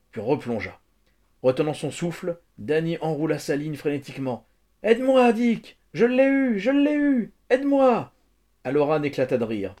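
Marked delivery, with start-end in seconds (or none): 4.8-8.1 put-on very high, loud child's cries; excited shouting. A narrator's reading. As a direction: call for help in breathless triumph over a catch. Child lands fish, calls for help.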